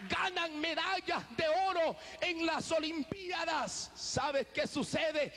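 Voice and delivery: rising, shouted preacher's cries of praise; full-force preaching. Acted. Preacher rousing the congregation, shouting praise. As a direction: preach it out to the whole church.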